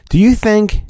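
normal talking voice

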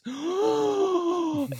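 high sharp shocked gasp; imitated gasp. Host imitating the neighbor's shocked reaction, sharp gasp. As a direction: suck in air in horror.

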